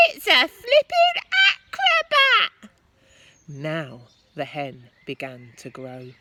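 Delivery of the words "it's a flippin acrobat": very high, loud, slow granny shriek; shouting Grandma. A narrator's reading. Grandma shouting from the rooftop at the hen. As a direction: crow it down like a gleeful old woman. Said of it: "Grandma shouted from the rooftop".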